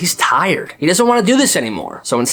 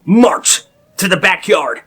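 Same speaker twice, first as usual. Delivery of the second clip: mock command; 3 semitones higher, slower than usual. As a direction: order them out like a drill sergeant. Parents marching kids to burn pile.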